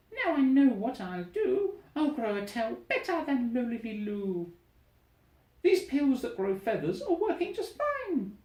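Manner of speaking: a greedy bird with a bright idea; scheming little bird. Acted. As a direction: Gertrude planning a bigger tail, high and gleeful. Gertrude scheming gleefully.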